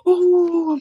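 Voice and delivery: long, high, loud "oh"; loud exclamation. Real, not acted. Player reacting mid-game, loud exclamation. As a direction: cry out high and drawn-out.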